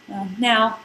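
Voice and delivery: plain reading voice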